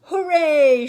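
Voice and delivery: big high joyful shout; shouted hooray. A narrator's reading. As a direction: cheer all together at full voice. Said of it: "shouted the little beaver".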